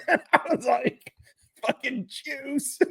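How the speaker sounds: very high, laugh-choked voice; helpless giggle squeezing the words. Real, not acted. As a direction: barely get it out for laughing. Cracking up at own punch line.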